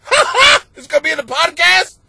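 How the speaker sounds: high, loud, laughing boss imitation. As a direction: play the boss cracking up mid-sentence. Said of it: "He starts laughing at me".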